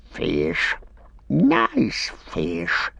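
croaking creature voice rising on nice; Gollum voice. A narrator's reading. Gollum alone in the dark, crooning over fish in a creature voice. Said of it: "said the voice".

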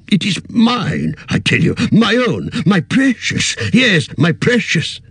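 cling to the ring greedily; a hint of Gollum's greed; possessive.

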